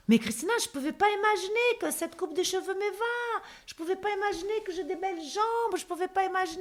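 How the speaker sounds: high, soft gushing; delighted client quote. Retold or imitated. Makeover client marvels at her new look, high-pitched delight.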